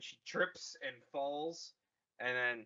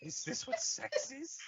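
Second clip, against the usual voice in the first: much higher, dopey wondering voice; naive Kylo voice; 12 semitones higher, 3 dB quieter than usual. Pretend. Kylo after being stabbed, dopey wondering voice. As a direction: ask it like a clueless boy.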